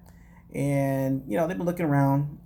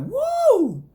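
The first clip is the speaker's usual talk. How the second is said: high, louder squealed woo in a put-on voice; mock excited woo; 6 dB louder than usual. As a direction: echo the squeal as the realtor. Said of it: "The realtor's like".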